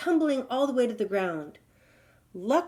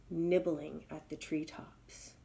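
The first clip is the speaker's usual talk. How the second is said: ordinary delivery, nothing marked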